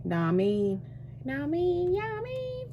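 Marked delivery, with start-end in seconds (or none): 1.2-2.7 much higher, squeaky echo of the phrase; high mock echo. Echoing herself to the camera in a squeaky high voice. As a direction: play the line back in a tiny high voice.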